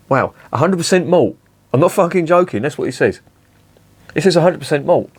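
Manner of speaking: high-pitched, scoffing disbelief; incredulous. Real, not acted. Reviewer reading a dubious claim off the can, incredulous. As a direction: marvel at it, pitch jumping up.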